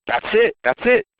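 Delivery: high-pitched retold burst of recognition; excited, high, quick repeat; excited eureka. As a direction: re-enact the moment the idea clicks, pitched right up. Retells his excited eureka moment.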